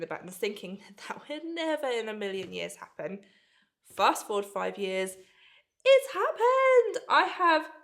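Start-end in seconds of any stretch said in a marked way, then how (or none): 5.8-7.7 high, slow burst of real delight; thrilled. Real, not acted. Vlogger telling subscribers her dream collab finally happened, thrilled. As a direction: let the joy burst out, stretched and soaring.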